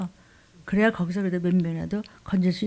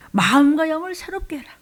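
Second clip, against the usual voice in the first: preached emphatic repeat; 7 semitones higher, 5 dB louder than usual. Shown as a demonstration. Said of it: "verse repeated with force".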